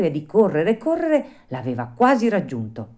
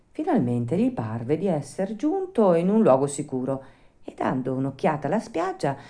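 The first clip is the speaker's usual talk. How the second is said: plain reading voice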